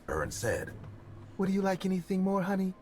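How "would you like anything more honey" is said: sweet creepy voice. Acted. Man sweetly asks girl.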